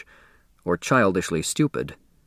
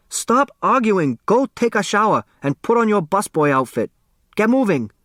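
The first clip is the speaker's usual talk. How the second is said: scolding father; 9 semitones higher than usual. Acted. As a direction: bark out the orders at the lazy boy. Father scolds his son.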